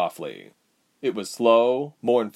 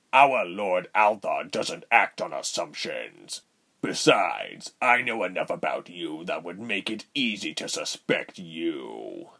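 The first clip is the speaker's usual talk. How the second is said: slow villain voice, sinking low; deliberate threat that drops away at the end; menacing villain; 3 semitones lower than usual. Acted. Voices villain Reuben in story.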